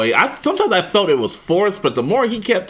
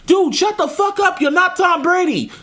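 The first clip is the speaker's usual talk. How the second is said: mock exasperated retort; 13 semitones higher than usual. Viewer talking back to the quarterback, high exasperated retort. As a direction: tell him off sharply. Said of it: "I'm like".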